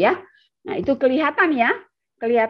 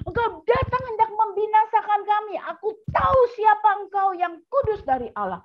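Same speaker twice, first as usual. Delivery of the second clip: possessed man's shriek; 10 semitones higher than usual. Acted. The possessed man cries out at Jesus in a high demonic shriek. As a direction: shriek like the demon inside him. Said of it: "berteriak dengan suara keras".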